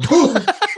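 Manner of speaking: high, sudden "whoo"; mock startled cry, pitch shooting up; imitated startled whoop. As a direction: mimic someone jumping in shock. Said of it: "like that".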